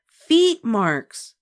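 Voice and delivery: high, loud, dug-in insistence; stubborn. A narrator's reading. Little girl stubbornly contradicts her sister, stubborn. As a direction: plant your feet and refuse. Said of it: "said Ramona stubbornly".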